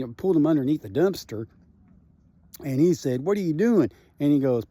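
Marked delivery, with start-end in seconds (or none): 3.2-3.9 higher and louder, caught off guard; startled question. Retold or imitated. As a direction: blurt it out, surprised at being grabbed. Paul pulled under the dumpster.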